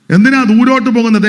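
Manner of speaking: loud, high rhetorical question; loud challenge. Acted. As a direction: throw the question at the crowd.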